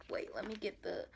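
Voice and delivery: unvoiced hurried whisper. Real, not acted. Whispering to viewers while reaching for the palette. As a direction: breathe it out with no voice.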